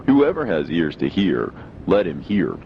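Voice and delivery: ordinary talk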